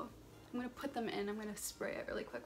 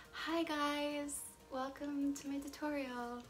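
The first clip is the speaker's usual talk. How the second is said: perky higher-pitched on-camera presenter voice; 5 semitones higher, slower than usual. Shown as a demonstration. Vlogger greets her viewers in her fake camera voice. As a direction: put on a bright YouTube intro voice. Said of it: "But that's, like, not my real voice".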